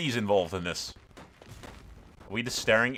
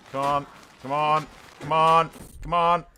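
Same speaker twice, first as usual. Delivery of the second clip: each come on a little higher and louder; building urging; 6 semitones higher, 4 dB louder than usual. Real, not acted. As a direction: plead with the troops, building. Urging AI allies to arrive.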